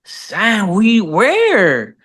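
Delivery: high-pitched acted confusion; baffled. Dad asks his kids where this Sam is, baffled. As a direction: play a dad searching, totally puzzled.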